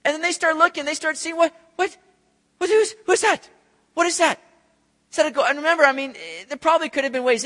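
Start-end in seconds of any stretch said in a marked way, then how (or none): 1.4-4.4 high, loud startled cries of "what's that"; startled disciples. Retold or imitated. Disciples spotting a figure on the waves, startled and loud. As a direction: squint at the waves, alarmed and puzzled.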